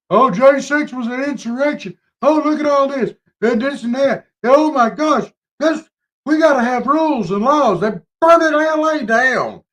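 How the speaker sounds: high, whiny mock outrage; mocking liberals. Mocking the left over January sixth, a high, whiny mock voice. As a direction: ham up the phony hand-wringing.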